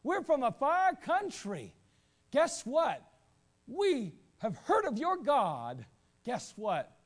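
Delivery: high, loud, slow sales pitch; fawning Gibeonites. Retold or imitated. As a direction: oversell it like a con man. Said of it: "approached Israel and said".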